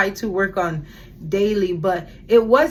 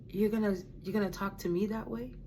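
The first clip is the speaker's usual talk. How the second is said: much softer, quick-spoken reproof; quiet rebuke; 10 dB quieter, faster than usual. Retold or imitated. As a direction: voice God's hushed challenge. Voicing God's quiet rebuke.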